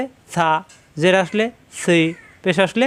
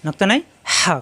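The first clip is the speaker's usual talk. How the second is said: usual speaking voice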